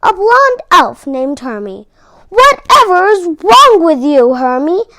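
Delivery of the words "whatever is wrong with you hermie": louder, higher put-on boss elf voice; hammed-up grumpy boss elf; bossy elf. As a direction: scold the odd elf like a cranky foreman. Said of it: "asked the boss elf".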